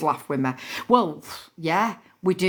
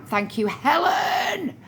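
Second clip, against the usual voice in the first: tearful thanks; 7 semitones higher, much slower than usual. Real, not acted. Emotional thanks on live stream.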